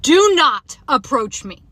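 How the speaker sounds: loud, slow, stretched command; retold yell. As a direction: warn him off firmly and loudly. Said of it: "I literally yelled at him".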